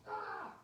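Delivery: high little mock shriek of fright; a kid's mock shriek at a worm; mock scream. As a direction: yelp like a startled kid.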